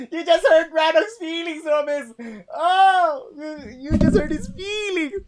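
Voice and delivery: high, hammed-up fake sob; mock crying. Listener pretending to cry for Rannoch's hurt feelings. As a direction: whine it like a pretend crybaby.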